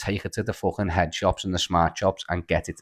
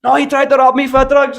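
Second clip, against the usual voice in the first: much higher, louder mock yell; retold shout from the street; 9 dB louder than usual. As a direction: shout back up at the window.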